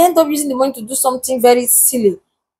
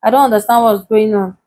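ordinary delivery

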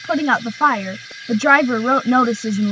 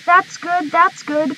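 higher, puffed out relief; puffing; 6 semitones higher, slower than usual. Acted. Thomas puffing as he starts off, higher. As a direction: puff it out as you start. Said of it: "puffed Thomas".

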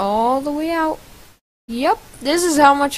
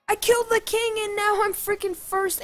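giddy disbelief; 8 semitones higher, 5 dB quieter than usual. Real, not acted. Thrilled at taking first place.

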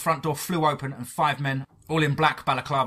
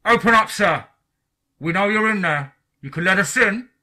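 high, loud demand through a door; forceful raised-voice command; agent shouting; 8 semitones higher, 9 dB louder than usual. A narrator's reading. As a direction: shout it as an order from outside. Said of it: "The agent outside began shouting".

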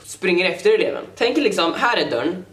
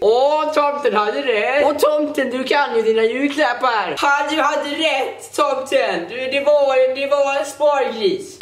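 higher, slow mocking singsong; teasing crowd; 5 semitones higher, slower than usual. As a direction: tease like a laughing crowd. Crowd mocking about Santa. (retsam hånande publik)

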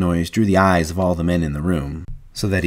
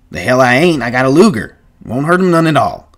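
loud, high, brash contradiction; emphatic retort; 6 semitones higher, 7 dB louder than usual. Acted. Carlson insisting to Candy that he has a gun, brash. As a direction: override the objection forcefully.

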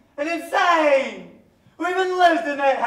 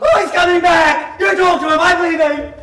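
higher, loud frightened shout; frightened cry as the madman returns; panicked shout; 5 semitones higher, 10 dB louder than usual. Acted. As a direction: warn them in sudden alarm.

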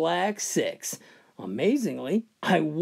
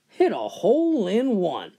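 ordinary delivery

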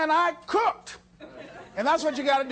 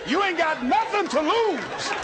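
self pep talk; 6 semitones higher than usual. Retold or imitated.

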